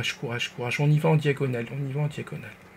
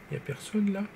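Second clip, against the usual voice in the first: hushed; 4 semitones higher, 10 dB quieter than usual. Real, not acted. Sneaking, checking for enemies.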